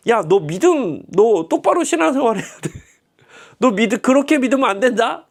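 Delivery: mock-scold the doubting father; scolding Jesus. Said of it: "지금 예수 이러시고 있는 거예요". (믿음 없는 아버지를 높고 크게 꾸짖는 예수 흉내)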